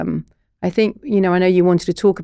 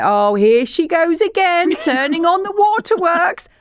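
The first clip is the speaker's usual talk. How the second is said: much higher, slow mocking dad; 9 semitones higher than usual. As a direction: mimic a dismissive father. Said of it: "he'd say".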